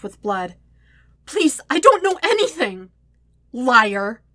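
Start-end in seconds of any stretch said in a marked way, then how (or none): 1.3-2.9 high, loud desperate plea; panicked pleading. A narrator's reading. Pinned man begging his attacker. 3.5-4.2 loud, angry accusation; snarl. A narrator's reading. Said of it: "Jet snarls".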